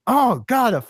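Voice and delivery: overjoyed fan squeal; excited gush. Real, not acted. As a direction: pitch leaps high, real delight. Gushing over the soundtrack.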